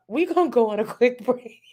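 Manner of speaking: can't finish for laughing. Real, not acted. Announcing a break to chat while giggling.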